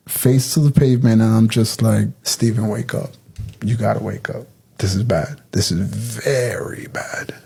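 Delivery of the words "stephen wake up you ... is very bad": hushed inner plea. Retold or imitated. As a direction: quietly urge your partner, as if thinking it. Silent plea to handcuffed partner.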